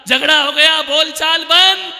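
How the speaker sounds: high, loud proclamation; dramatic shout. Acted. Storyteller announcing the couple stopped talking, shouting dramatically. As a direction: proclaim it at full voice.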